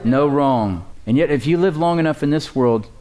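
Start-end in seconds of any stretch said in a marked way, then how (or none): 0.0-0.9 slow, weighty, said together; slow congregational recital. Shown as a demonstration. Pastor leading the congregation in a slow recital. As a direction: lead the church in saying it slowly. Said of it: "Say that with me".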